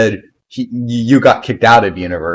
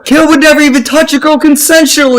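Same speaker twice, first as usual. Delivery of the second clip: mock defence; 4 dB louder than usual. Joking mock defence of Caleb.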